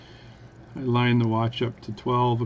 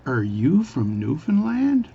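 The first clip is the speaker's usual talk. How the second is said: slow, higher-pitched careful question; really slow speech; 5 semitones higher, slower than usual. Acted. Bartender questioning the Newfie, really slowly. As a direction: space out each word as if to a foreigner. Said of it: "is speak really slowly".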